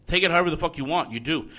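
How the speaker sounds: ordinary delivery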